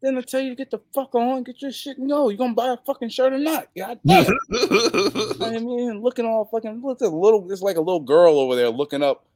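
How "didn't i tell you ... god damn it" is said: high, heated exasperated rant; exasperated yelling. Retold or imitated. Wrestler scolds the pushy fan, exasperated rant. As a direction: scold him like you've had enough. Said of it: "like, like".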